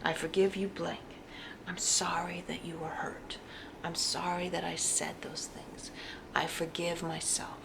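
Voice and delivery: soft whispered forgiveness; quiet heartfelt whisper; whispered affirmation. Shown as a demonstration. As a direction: whisper it like a private vow. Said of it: "really feel it as you're saying it".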